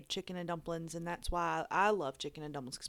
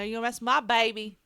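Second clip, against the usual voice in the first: mock tough catchphrase; 3 semitones higher, 8 dB louder, faster than usual. Echoing the catchphrase louder.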